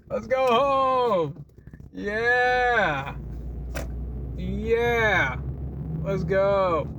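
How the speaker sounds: drawn-out, slow celebratory cheer; mock cheer. Real, not acted. Cheering to himself as he finally leaves work, drawn out. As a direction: cheer yourself out the door, stretched out.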